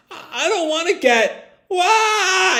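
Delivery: very high, stammering wail breaking into a cry; a little kid losing it in fear; panicking child. Retold or imitated. Ash panicking on the log, high stammering wail. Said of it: "Ash is just panicking".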